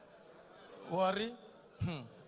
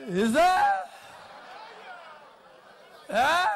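calling out; 4 semitones higher, 6 dB louder, slower than usual. Real, not acted. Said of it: "repeated call to audience".

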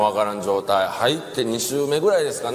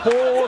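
a hooting "ほー" pitched up, hollow like a softly blown ocarina; 10 semitones higher, 4 dB louder than usual. Retold or imitated. Injured senior answering from the car, airy ocarina hoot. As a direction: blow it out like a gentle ocarina note, half laughing. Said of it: "オカリナをそーっと吹いた時みたいな".

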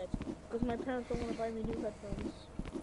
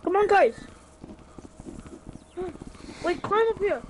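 calling out; 6 semitones higher, 8 dB louder than usual. Real, not acted. Calling friends to climb.

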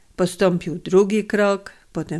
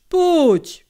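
"pójdź" pitched much higher and louder, pleading; kindly coaxing; 10 semitones higher, 5 dB louder than usual. A narrator's reading. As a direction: coax harder, lifting the voice. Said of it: "jął dobrotliwie przemawiać".

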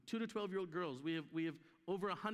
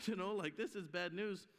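ordinary talk

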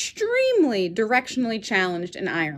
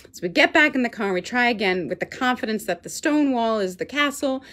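ordinary talk

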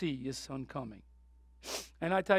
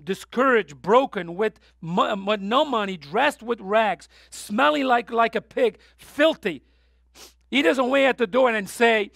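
louder, higher impassioned preaching; preaching at full force; 5 semitones higher, 7 dB louder than usual. Acted. Preacher to congregation describing the prodigal's return, fervent. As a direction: drive the story home with fire.

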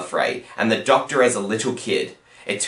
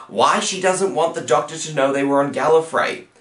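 usual speaking voice

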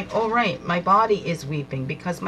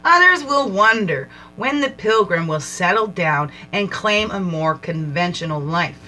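measured, reflective reading voice; card reading; 4 dB louder than usual. Acted. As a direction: read the pilgrim card like a meditation. Reads oracle card text aloud.

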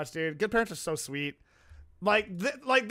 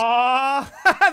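long, high, loud "aww" breaking into laughter; adoring coo; 5 semitones higher, 8 dB louder than usual. Real, not acted. Streamer reacts to Goth Baby video, adoring coo. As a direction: melt over something cute.

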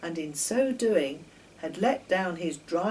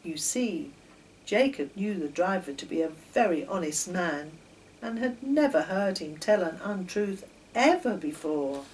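ordinary delivery

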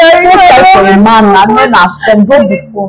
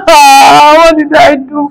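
wailing sob; 3 semitones higher, much slower than usual. Acted. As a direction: break down in slow sobs. Breaks down crying.

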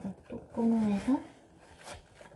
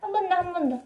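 fast, higher, louder urging; excited; 8 semitones higher, 6 dB louder, faster than usual. Real, not acted. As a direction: hype yourself up for another try. Urging another try.

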